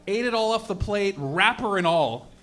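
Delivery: usual speaking voice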